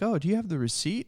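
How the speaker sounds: quick, high put-on voice for her question; mimicked questioner. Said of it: "She was like".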